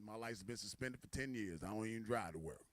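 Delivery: low, soft refusal; low-voiced man, not interested; unimpressed employee. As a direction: drop deep and quiet to decline. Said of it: "He said".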